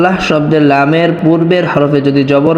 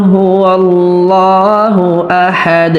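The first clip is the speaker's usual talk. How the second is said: slow melodic recitation; recited slowly; 4 semitones higher, much slower than usual. Shown as a demonstration. Teacher modelling the verse for students, slow chant. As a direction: draw out قل هو الله like tilawa.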